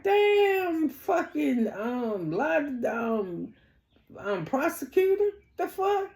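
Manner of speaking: high-pitched incredulous reaction; retold disbelief.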